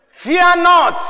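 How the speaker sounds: fear not, high and loud; proclaimed. Real, not acted. Preacher booming scripture to the church, high and loud. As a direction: ring it out to the congregation.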